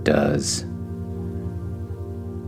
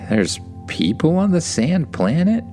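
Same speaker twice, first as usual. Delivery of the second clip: high, amazed question; surprised; 10 semitones higher than usual. Acted. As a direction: ask it in wonder.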